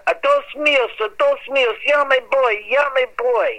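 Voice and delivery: high-pitched screamed cry, repeated; retold scream. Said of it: "And he screams out".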